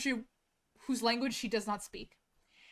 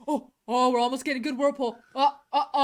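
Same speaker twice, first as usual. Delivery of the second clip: lightly voiced excited drain guy, louder; 7 dB louder than usual. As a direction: mimic a man thrilled by his whirlpool. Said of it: "and then he's like".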